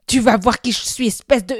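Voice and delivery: loud, furious threat; furious yell. Acted. Enraged boy yelling at his tormentor as he charges. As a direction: roar it as you charge. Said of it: "hurla-t-il".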